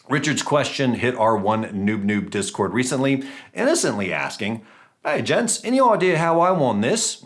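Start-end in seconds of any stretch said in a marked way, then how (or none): 5.0-7.2 higher-pitched, badly imitated English accent; amateur go at an English gent's accent. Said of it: "really bad job of imitating his English accent".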